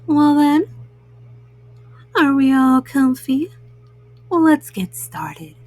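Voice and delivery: ordinary delivery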